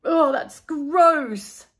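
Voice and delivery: slow, nasal-pinched disgust; slow, loud grossed-out groan; pinched nose. A narrator's reading. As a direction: say it holding your nose. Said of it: "pinching his nose".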